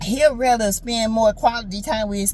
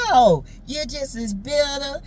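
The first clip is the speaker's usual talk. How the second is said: ordinary talk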